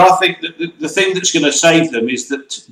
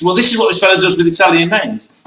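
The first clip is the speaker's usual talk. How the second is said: normal talking voice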